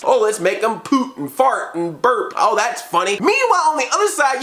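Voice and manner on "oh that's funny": ordinary talk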